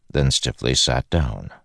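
plain reading voice

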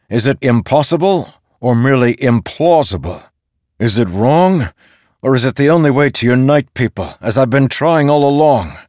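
high, loud, insistent questioning; demanding. A narrator's reading. Dalinar pressing the storm god for answers, demanding. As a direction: press each question harder. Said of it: "Dalinar demanded".

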